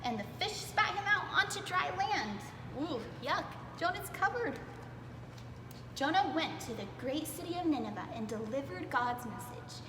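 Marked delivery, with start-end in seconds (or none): none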